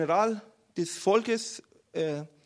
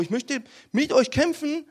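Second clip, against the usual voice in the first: high, loud, keen appeal; eager pleading; 7 semitones higher, 7 dB louder than usual. Acted. David asking to join the battle, eager and loud. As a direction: plead to be let into the fight.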